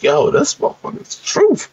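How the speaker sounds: squeaky high amazed quote, laughing at the end; amazed gamer. Retold or imitated. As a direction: go way up in pitch, stunned and laughing. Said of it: "And I'm like".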